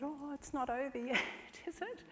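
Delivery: high, soft little voice; child's plea. Retold or imitated. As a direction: imitate a boy sorry the shoot is ending. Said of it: "he said".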